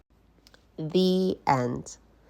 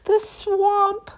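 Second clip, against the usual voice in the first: very high, loud shriek; horrified kids; 5 dB louder than usual. Acted. Kids recognise Miss Swamp, high terrified shriek. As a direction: shriek in terror.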